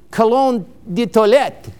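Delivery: cologne de toilette in a playful mock French accent; lightly Frenchify cologne de toilette, ko-LONE duh twa-LET; mock French accent for a fancy perfume name. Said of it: "You have to say it with a French accent".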